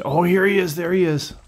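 sudden excitement. Real, not acted. Player sees the bass come back, sudden excitement. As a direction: blurt it out as you spot it. Fish reappears near the lure.

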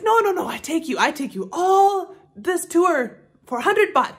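high, insistent imitation of a Thai accent; mock Thai accent. Local man haggling over a tuk-tuk tour, mock Thai accent. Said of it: "go into a bit of an accent".

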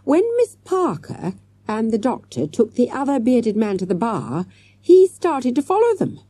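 animated, urgent explaining; excited cry. A narrator's reading. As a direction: blurt out the discovery. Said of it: "he cried".

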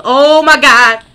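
loud, high panic shout; panicked yelling. Pretend. Player panicking as a fire breaks out, loud shout. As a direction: scream it like the room's on fire.

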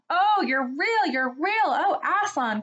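high, loud joyful cry; overjoyed. A narrator's reading. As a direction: burst out with relief and joy. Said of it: "cried Lucy".

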